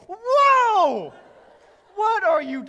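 pained yelp. Retold or imitated. Husband yelping at wife mid-pluck, loud high shocked whoa. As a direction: cry out as the tweezer bites. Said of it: "And I go".

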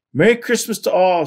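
high, loud festive shout. A narrator's reading. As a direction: shout the greeting to everyone. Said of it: "shouted Froggy".

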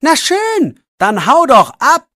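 high, loud angry retort; angry shout. A narrator's reading. Jannik shouting back at Max, angry. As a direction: snap back just as mad. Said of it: "ruft Jannik genauso sauer wie Max".

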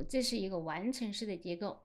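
usual speaking voice

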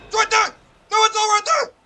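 sudden high, piercing yell; panicked screaming. Real, not acted. Streamer spotting the thing, panicked screaming. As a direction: explode into a scream.

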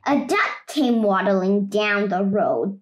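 plain reading voice

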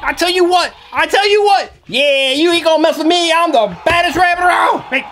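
high-pitched, feisty cartoon rabbit; Snowball. Rabbit confronting a creature over a carrot, feisty. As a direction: play a scrappy little tough guy picking a fight. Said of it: "I'm Snowball from Secret Life of Pets".

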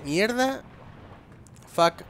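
ordinary delivery

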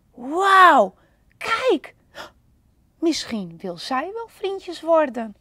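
wow, look, a new friend!; excited wonder. Acted. Lonely Panda spotting another panda, thrilled and high.

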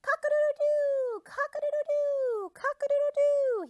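very high, loud cock-a-doodle-doo; rooster crowing. Acted. Rooster crows at dawn to wake the animals, very high and loud. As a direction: crow full out like a proud rooster. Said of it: "he crowed".

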